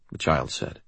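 usual speaking voice

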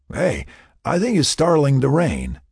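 tipsy slur. A narrator's reading. Drunk man noticing rain, slurs it.

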